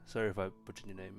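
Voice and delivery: usual speaking voice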